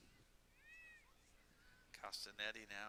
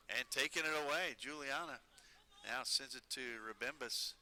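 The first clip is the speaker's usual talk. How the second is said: higher and louder as the break develops; rising commentator energy on a breakaway; excited call; 4 semitones higher, 6 dB louder than usual. Real, not acted. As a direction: ride the counterattack in your voice. Live play-by-play, counterattack.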